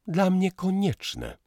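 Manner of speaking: high, curt retort; cutting her off. A narrator's reading. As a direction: cut him off sharply. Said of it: "ona ucięła z miejsca".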